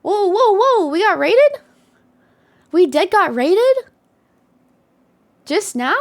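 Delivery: high, loud stunned whoas; surprised. Real, not acted. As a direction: caught off guard by the raid. Surprised by a sudden raid.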